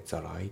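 "ザライ" run together, T softened to an L; linked pronunciation. Shown as a demonstration. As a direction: tap the T into I in "ザライ". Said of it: "リンキングで".

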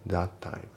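"that time" as "tha-time"; dropped T. Shown as a demonstration. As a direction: merge the Ts in "that time" into one. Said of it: "thatのTも抜けますね".

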